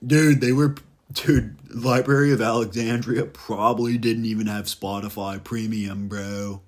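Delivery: loud dude voice, easing off at the end; hammed-up bro talk; mock bro. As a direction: play an astonished bro looking down on history. Voices a modern bro judging history.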